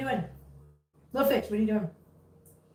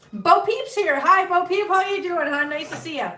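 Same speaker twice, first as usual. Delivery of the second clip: excited greeting; 8 semitones higher, 6 dB louder than usual. Real, not acted. Greeting a viewer.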